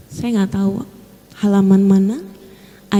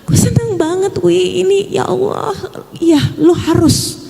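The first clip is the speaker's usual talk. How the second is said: much higher, gushing with happy tears; a manager's emotional, high-pitched delight; overjoyed friend; 7 semitones higher than usual. Retold or imitated. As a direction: retell the friend's joyful outburst, laughing through it. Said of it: "Dia nangis dan cuma bilang".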